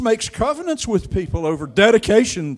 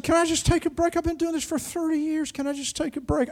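high pleading; 9 semitones higher, 6 dB quieter than usual. Retold or imitated. Pleading with the Lord again.

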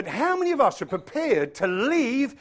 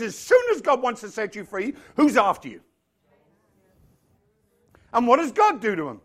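usual speaking voice

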